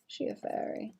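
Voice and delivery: breathy whisper, wondering; whispered question. Real, not acted. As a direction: whisper it to yourself. Musing to herself.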